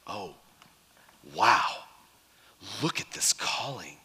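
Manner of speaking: hushed, breathless awe; awed whisper. Acted. Disciples awed by the calling, whispering in wonder.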